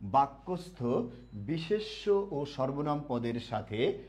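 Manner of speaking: "বিশেষ্য ও সর্বনাম পদের সাথে" spoken slowly, word by word; slow dictation. Shown as a demonstration. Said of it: "সবাইকে লেখা হয়েছে এটা?". ("বিশেষ্য ও সর্বনাম পদের সাথে" ধীরে, থেমে থেমে বলা)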